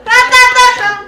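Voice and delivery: yelling stop in a panic; loud frantic stop. Real, not acted. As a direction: shout it out, frantic. Frightened by something in box.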